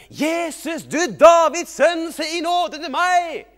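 very high, drawn-out full shout. Acted. Blind man refusing to be hushed, very loud slow shout. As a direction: yell it slowly at the top of your voice. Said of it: "han ropte bare enda høyere".

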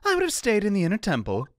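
lightly voiced, pleading witness; game witness. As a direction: explain yourself as an animated witness. Witness answers the judge.

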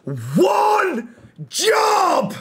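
high, loud wail of despair; exasperated shout. Real, not acted. Player yelling at a teammate's own goal, high and loud. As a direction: cry out at the blunder.

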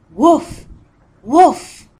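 loud barking woof, woof; dog bark. Shown as a demonstration. Teacher showing kids a dog, loud barking. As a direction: bark it like a puppy. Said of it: "A dog says".

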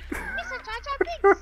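high, loud, slow cartoon voice; Jar Jar voice. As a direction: belt it out like Jar Jar. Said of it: "Jar Jar impression?".

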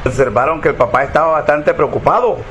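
ordinary talk

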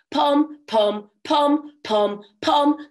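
loud brassy pom-poms; euphonium pom. Acted. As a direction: play it like a horn. Imitating the euphonium.

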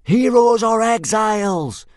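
high, loud chanting shout; piercingly high rallying cry; fanatical yell. A narrator's reading. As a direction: yell it back like zealous boys. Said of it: "yelled eighteen boys fanatically back".

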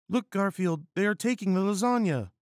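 high, loud, hurried female character voice; helpless cry. Acted. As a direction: cry out in a hammed-up high-pitched alarm. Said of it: "cried out Miranda in helplessness".

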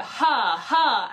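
"ha, ha" with air pushed through parted cords; breathy H onset. Shown as a demonstration. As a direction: start each "ha" on a puff of breath. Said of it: "stay a little bit more separate".

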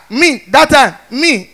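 ordinary delivery

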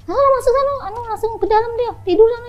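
put-on aunt ordering the drunk nephew off to bed; shooing scold. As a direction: shoo him inside, high and bossy.

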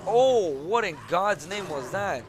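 loud, high, drawn-out alarm; startled outcry. Real, not acted. Gamer hit by something unknown, startled outcry. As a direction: cry out slowly in loud disbelief.

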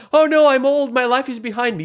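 high, loud fake self-pity; mock whiny young star. Young pop star tweets he is old, hammed-up whining. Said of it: "he's gonna tweet something like".

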